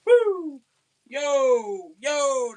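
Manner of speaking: high, loud calls of woo and yo; imitated hollering. Cousins driving the deer, loud mock hollers. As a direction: shout out like calling across the woods.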